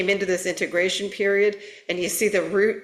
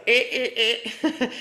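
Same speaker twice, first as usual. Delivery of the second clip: short higher strained eh sounds; mock tense grunts; 5 semitones higher, slower than usual. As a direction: squeeze out tight little ehs like a clenched stomach. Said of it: "make some of the solar plexus go".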